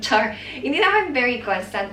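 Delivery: a laugh breaking into a joking take-back. Real, not acted. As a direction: laugh as you admit you exaggerated. Teasing correction of own claim.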